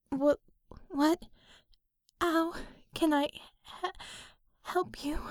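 high, halting, breaking whisper; croaking with fear. Acted. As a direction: stammer out in awe and fear. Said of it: "finally managed to croak".

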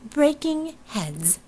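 both words leaned on, slow and high; emphasized words. Acted. Fata to Robinson, slow emphasized breaking heads. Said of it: "Fata emphasized the words".

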